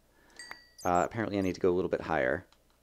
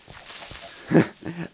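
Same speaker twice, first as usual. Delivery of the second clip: real, higher-pitched laugh after a scare; relieved chuckle; 7 semitones higher than usual. Real, not acted. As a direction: laugh off the near miss. Laughs after the near miss.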